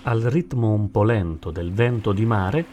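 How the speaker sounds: plain reading voice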